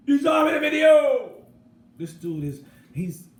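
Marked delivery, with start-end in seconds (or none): 0.1-1.3 full-throated high shout. Real, not acted. Vlogger shouting that viewers saw R. Kelly, full yell. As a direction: yell the point at the camera.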